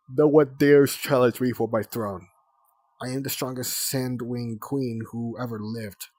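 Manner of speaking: loud, hammed-up royal boast; a child playing a grand queen, boasting loudly; mock queen voice. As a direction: strut it like a pompous queen. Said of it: "I've been queen for ages and ages".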